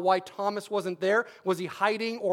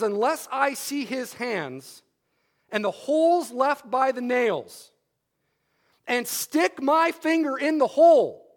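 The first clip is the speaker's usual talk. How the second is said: slow, from plain to louder and higher; emphatic, building; 4 semitones higher, 4 dB louder, slower than usual. Retold or imitated. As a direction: build into a forceful demand. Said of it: "now it's emphatic and it's graphic".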